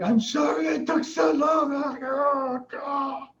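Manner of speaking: very high, drawn-out imitated wail; mock bawling. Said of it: "we're just bawling our eyeballs out".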